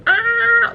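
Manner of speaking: brief high-pitched panic yelp; mock scream. Recalling fear of pulling the fire alarm, mock scream.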